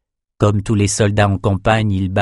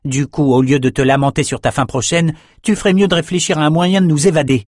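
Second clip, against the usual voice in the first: higher-pitched, brisk scolding; impatient young prisoner; 6 semitones higher than usual. A narrator's reading. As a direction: push them to think of escape. Bannon scolds his cellmates.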